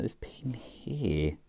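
ordinary delivery

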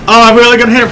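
loud, high burst of alarmed excitement; excited realisation. Real, not acted. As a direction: exclaim as the crash becomes certain. Realises crash is coming.

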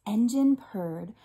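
ordinary delivery

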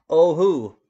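loud eager "Oh, who?"; loud joke reply. Pretend.

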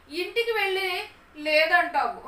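high, loud, drawn-out complaint; acted childish objection, stretched out; protesting child. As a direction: protest slowly as a distrustful son. Boy protests father will go back.

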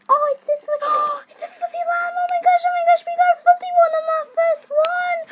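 high, loud squealing over the find; thrilled squeal. Real, not acted. Finding the fuzzy lamb on camera, high thrilled squealing. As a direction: shriek with delight at the fuzzy lamb.

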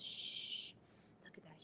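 hushed whisper. Shown as a demonstration. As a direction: shush the kids and whisper. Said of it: "very quiet".

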